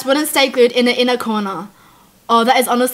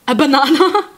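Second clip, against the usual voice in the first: laughing disbelief. Real, not acted. Incredulous at crying over banana.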